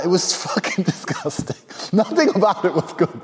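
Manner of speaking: high voice breaking into real laughter; laughing disgust. Real, not acted. Storyteller recalling a vile party, real disgusted laughter.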